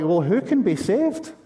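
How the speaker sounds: mock panic. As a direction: play the alarmed crowd, voice jumping up in Scottish-free mock alarm. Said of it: "the surrounding crowd do go into a bit of panic".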